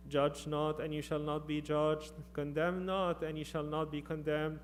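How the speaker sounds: normal talking voice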